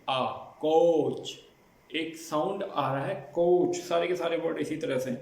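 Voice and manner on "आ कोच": drawn-out "आ" then "कोच", very slow; stretched vowel demo. Shown as a demonstration. Teacher showing students the O sound in कोच, drawn out. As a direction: stretch the vowel out long before saying कोच. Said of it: "ओ ए की आवाज़ आ रही है".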